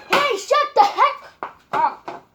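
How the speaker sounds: slow, high, loud yell; angry shout. Real, not acted. Yelling at others off camera.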